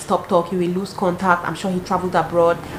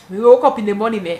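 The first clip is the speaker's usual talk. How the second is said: ordinary talk, nothing marked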